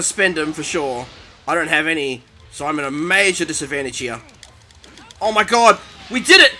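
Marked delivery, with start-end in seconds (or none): 5.2-6.5 elated relief. Real, not acted. Player just scraped a win, loud elation. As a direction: burst out, amazed at winning. Wins the match narrowly.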